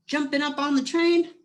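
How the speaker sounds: ordinary delivery, nothing marked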